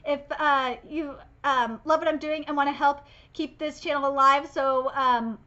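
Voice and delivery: soft, quick channel sign-off; outro plug. Acted. Host plugging the channel to viewers, casual outro read. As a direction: rattle off the plugs casually.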